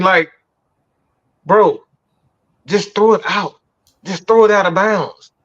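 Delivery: furious sports-show rant at the quarterback; angry rant. Real, not acted. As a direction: vent at the quarterback, voice climbing. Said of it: "Give me about five minutes to just rant".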